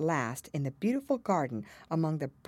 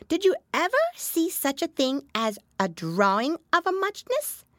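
high squeaky dormouse; startled awake little mouse; just-woken dormouse; 13 semitones higher, 5 dB louder than usual. A narrator's reading. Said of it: "woke up again with a little shriek".

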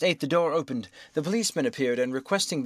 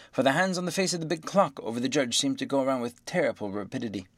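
ordinary delivery, nothing marked

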